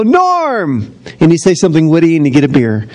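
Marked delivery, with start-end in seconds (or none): 0.0-0.9 high shouted welcome; Cheers crowd greeting. Retold or imitated. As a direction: greet him like the bar regulars do. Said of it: "everybody go".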